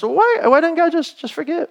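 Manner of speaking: high, incredulous mock question; mock objection. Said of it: "So it's not just".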